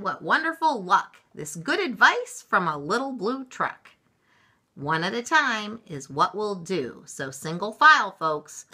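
loud, lifted public address; mayor's speech. A narrator's reading. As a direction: proclaim it to a whole crowd. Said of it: "gave a speech the way mayors do".

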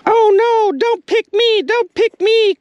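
very high squeaky little bait voice begging; pleading bait spike. Pretend. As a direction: play a tiny spike pleading not to be picked. Spikes begging not to be chosen.

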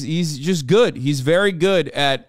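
ordinary delivery

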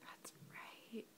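breathy whispered praise; whispering. Real, not acted.

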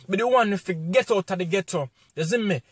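usual speaking voice

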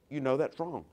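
a soft nudge of conscience; quiet conviction. Acted. Holy Spirit convicting softly.

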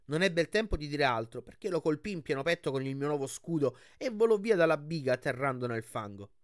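usual speaking voice

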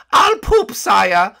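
high, loud whiny servant voice; whiny guard. Acted. Woken by his captain, a high loud whiny guard. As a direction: whine up high at being woken.

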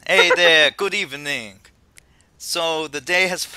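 loud, hammed-up Jamaican greeting; Jamaican accent. Host retries the professor's welcome to players, Jamaican accent. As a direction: boom it out in a shaky-attempt Jamaican accent.

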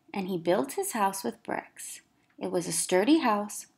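ordinary delivery

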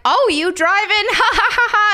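high-pitched mock question breaking into laughter; retold teasing.

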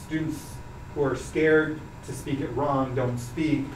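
normal talking voice

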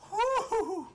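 high hooting exhale; real relieved "whew"; high shaken whew. Real, not acted. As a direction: blow the scare out in a hoot. Catching breath after the scare.

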